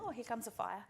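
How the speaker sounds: ordinary delivery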